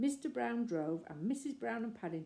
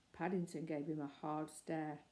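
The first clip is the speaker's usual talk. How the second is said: plain reading voice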